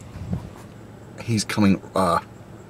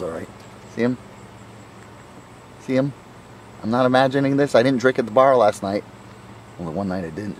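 ordinary delivery

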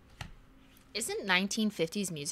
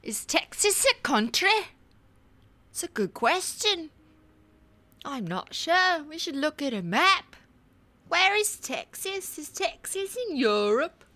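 much higher put-on voice; clueless geography questioner; 10 semitones higher, 7 dB louder than usual. Wondering whether Texas is in Europe, high clueless voice.

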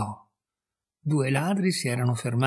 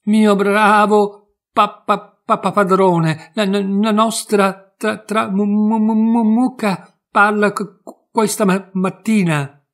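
high, loud, slow stutter; terrified stammer to the priest; stammering in fright; 7 semitones higher, 7 dB louder, slower than usual. A narrator's reading. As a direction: trip over every word in panic. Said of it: "balbettò la brava donna".

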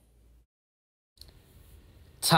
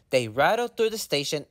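usual speaking voice

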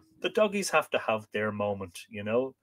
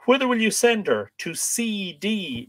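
lightly acted high question, deliberate answer; pantomime; 10 semitones higher, slower than usual. Reapers asking where to send the mare, light pantomime. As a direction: lift the question high, then answer slowly. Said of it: "A little bit of pantomime here".

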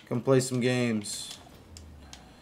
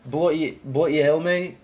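mock Australian accent, louder and higher; 5 semitones higher, 4 dB louder than usual. Streamer trying an Australian accent for chat, mock Australian. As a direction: have a rough go at an Australian accent. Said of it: "Australian accent".

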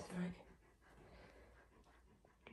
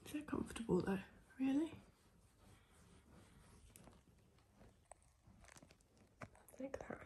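higher cooing pet voice; high pet talk; 5 semitones higher than usual. Real, not acted. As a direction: coo at the dog. Cooing at the dog.

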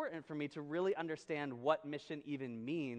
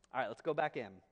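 ordinary talk, nothing marked

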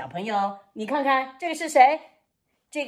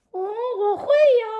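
puppet voice; 9 semitones higher, slower than usual. Acted. Teacher voices puppet Frankie.

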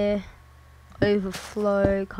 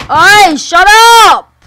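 loud, drawn-out yell, much higher; shout at dog; 10 semitones higher, 20 dB louder, much slower than usual. Real, not acted. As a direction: bellow at the noisy dog to shut up. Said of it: "Sorry if I'm hurting your ears".

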